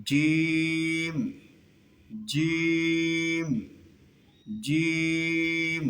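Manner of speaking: জিম with a hard J, long held "jeeeem"; correct Arabic jeem. Shown as a demonstration. Teacher answering how it should be, জিম with a drawn-out J. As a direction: strike a clean J on জিম and stretch the vowel. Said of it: "এইভাবে।".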